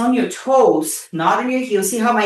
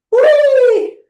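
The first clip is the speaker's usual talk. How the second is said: a high, loud whee; gleeful whee; 6 dB louder than usual. Real, not acted. Coach cheering the last reps with a high loud whee. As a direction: squeal with glee.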